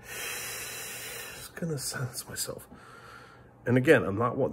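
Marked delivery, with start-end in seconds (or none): 0.0-2.7 slow, voiceless, much softer trailing sigh; sighed whisper. Real, not acted. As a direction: let it out on a tired breath. Sighing, going quiet.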